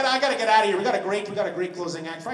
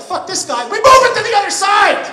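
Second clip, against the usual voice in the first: building to a loud, high shout; shouted mock planners; 10 semitones higher, 13 dB louder than usual. Comic acting road planners dismissing a driver, escalating to a shout. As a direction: blow up on the last sentence, yelling it out. Voices planners moving the exit.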